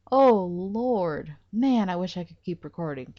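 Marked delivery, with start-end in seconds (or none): none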